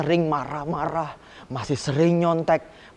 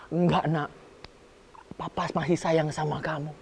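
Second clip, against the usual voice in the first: tender father; 4 dB quieter than usual. Acted. The father reassuring his repentant son, soft and tender. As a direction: hush and comfort him gently.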